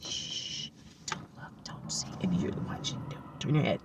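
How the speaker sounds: playful hushed whisper; secretive whisper. Pretend. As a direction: shush them and whisper conspiratorially. Pretend secret from watching couple.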